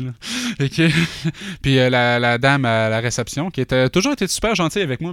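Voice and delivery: normal talking voice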